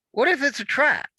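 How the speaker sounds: high, loud frightened question; panicked fear. Acted. Omrose fearing a trap in the cave, panicked high voice. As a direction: voice jumping up in terror.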